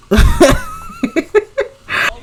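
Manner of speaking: very high burst of laughter; shrieking laugh. Real, not acted. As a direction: crack up out loud.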